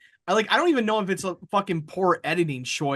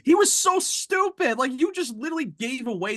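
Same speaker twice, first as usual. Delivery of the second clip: exasperated; 7 semitones higher than usual. Real, not acted. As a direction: stretch it in disbelief. Exasperated about the villain.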